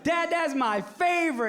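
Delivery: put-on high slow childish cheer; excited kid. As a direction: cheer like a thrilled little girl. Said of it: "my daughter goes".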